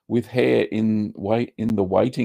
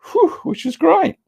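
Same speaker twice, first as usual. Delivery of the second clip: delighted whew. Real, not acted. Pleased at seventy-nine viewers.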